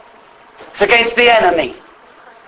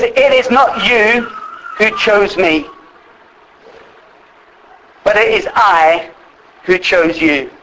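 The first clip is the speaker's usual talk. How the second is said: scripture reading. Acted. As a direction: read each phrase with a pause after it. Reading another translation slowly.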